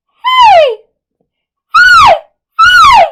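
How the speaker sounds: very high, piercingly loud hey; head-voice scream. Shown as a demonstration. Teacher demonstrates using the head for high notes, head-voice scream. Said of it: "we're going to scream".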